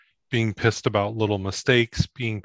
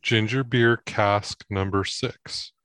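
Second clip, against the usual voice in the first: label read; slower than usual. Real, not acted. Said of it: "reads bottle label slowly".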